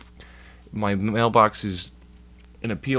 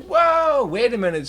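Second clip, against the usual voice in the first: high, loud startled whoa; surprised; 6 dB louder than usual. Retold or imitated. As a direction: pull up short in shock. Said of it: "I'm like".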